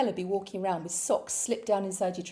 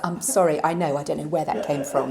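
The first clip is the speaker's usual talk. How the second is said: usual speaking voice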